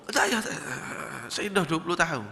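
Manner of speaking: ordinary talk, nothing marked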